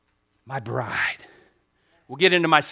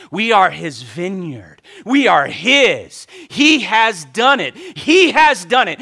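slow, stretched declarations growing louder; emphatic preaching; 5 semitones higher, 9 dB louder, slower than usual. Real, not acted. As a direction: build from firm to loud and high. Preaches with rising force.

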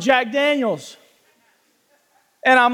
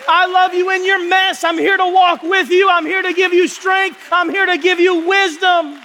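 preaching at full force; 5 semitones higher than usual. Acted. Pastor preaching to the church about sin, forceful. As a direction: drive it home like a preacher.

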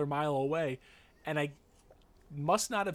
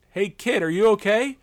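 louder, higher concerned call; 7 semitones higher, 12 dB louder than usual. Retold or imitated. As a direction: call out to the kid to check on him. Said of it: "I go".

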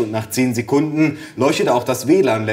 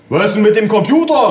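high, loud shouted question; father calling out; 9 semitones higher, 5 dB louder than usual. A narrator's reading. Father shouting from the kitchen, high-pitched. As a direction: call it through from another room. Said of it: "rief mein Vater aus der Küche".

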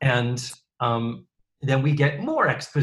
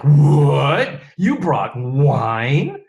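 shocked; 8 dB louder, much slower than usual. Acted. Blair's shocked quote, loud.

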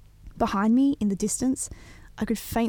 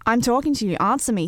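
put-on louder, higher drunk demand; snarled; 4 semitones higher, 6 dB louder than usual. Drunk stranger confronting the band, snarling louder. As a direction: snap at him like an aggressive drunk. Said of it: "he snarled".

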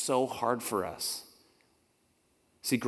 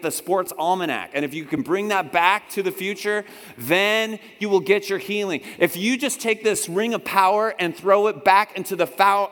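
mock grand demands; 5 semitones higher, 6 dB louder than usual. Mock servant's absurd demands.

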